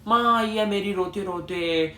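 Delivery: normal talking voice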